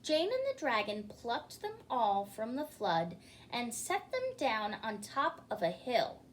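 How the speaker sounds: ordinary delivery, nothing marked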